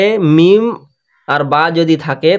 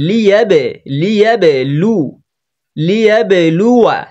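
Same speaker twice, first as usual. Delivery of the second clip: slightly raised, drawn-out chanting delivery; Quran recitation; 3 semitones higher than usual. Shown as a demonstration. Quran teacher building up the phrase for learners, measured recitation. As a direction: recite in measured pieces, holding the long vowel.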